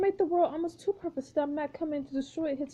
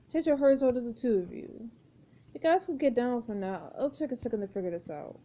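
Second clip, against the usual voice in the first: ordinary delivery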